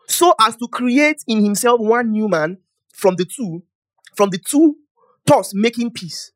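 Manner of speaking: loud, slow proclamation; forceful scripture reading. Real, not acted. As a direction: declaim the verse to the congregation. Reading scripture aloud in sermon.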